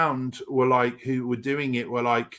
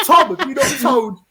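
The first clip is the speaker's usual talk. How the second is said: higher, louder, giggling; excited laughing call to Tom; 10 semitones higher, 8 dB louder than usual. Real, not acted.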